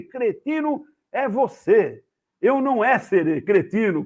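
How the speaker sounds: high, slow mock-Italian retort; bad tourist Italian. Tourist insults attendant back, hammed-up bad Italian accent. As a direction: argue in hammed-up broken Italian. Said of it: "imagina aquele cara, o turista que queria falar em italiano".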